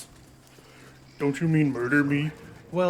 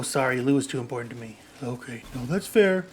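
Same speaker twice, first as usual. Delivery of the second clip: ordinary talk, nothing marked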